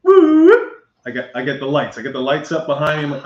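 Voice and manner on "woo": high, loud whoop of a siren; imitated police siren. Pulled over by police lights, imitated siren whoop. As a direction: whoop like a squad car siren.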